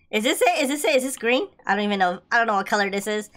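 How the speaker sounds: ordinary delivery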